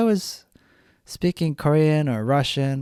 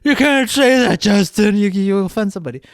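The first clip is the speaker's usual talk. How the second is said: higher, louder, whiny scolding; mock-offended kid, higher and louder; 6 semitones higher, 5 dB louder than usual. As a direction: nag like a prissy kid telling you off. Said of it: "most kids".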